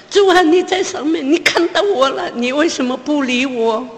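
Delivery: high, tearful plea to heaven; weeping, high-pitched cry to God; crying prayer. Retold or imitated. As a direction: sob it out looking up at the sky. Desperate mother crying to God.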